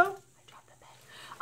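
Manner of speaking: unvoiced whispered aside. Real, not acted. Confessing a dropped pen to viewers, whispered. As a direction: breathe it out, no voice.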